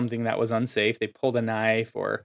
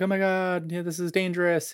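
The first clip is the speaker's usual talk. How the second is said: mock alarm, pitched up; super afraid; 6 semitones higher than usual. Onlooker at a bar fight, mock super afraid. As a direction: act out a mildly panicked onlooker. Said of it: "like, super afraid".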